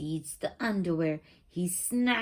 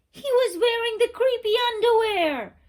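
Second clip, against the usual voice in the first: much higher and louder, startled; shocked reveal; 9 dB louder, faster than usual. A narrator's reading. Narrator springing the twist on listeners, shocked. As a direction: burst out the twist like a jump scare.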